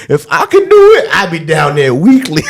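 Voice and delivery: high, loud hyped mock homie; hyped friend. Homie hyped about faking the accent, mock excited.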